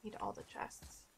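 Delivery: greedy little whisper. Real, not acted. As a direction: breathe it like a secret craving. Greedy gamer whispering about loot.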